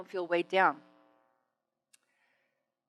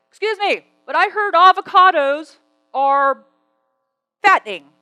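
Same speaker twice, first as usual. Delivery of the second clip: high, loud, drawn-out interruption; loud heckler; 8 semitones higher, 13 dB louder than usual. Retold or imitated. Man interrupting a talk about good fats, loud mock challenge. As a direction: butt in loudly, imitating an objecting audience member.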